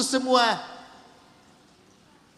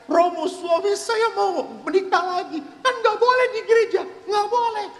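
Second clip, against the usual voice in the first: high-pitched laughing wife voice; high, half-laughing complaint to the priest; complaining wife; 12 semitones higher than usual. Acted. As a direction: squeal it out like an upset wife telling on him.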